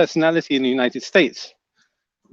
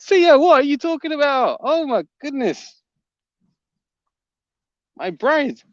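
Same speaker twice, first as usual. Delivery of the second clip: amused disbelief; 11 semitones higher than usual. Real, not acted. Reacting to an odd chat comment.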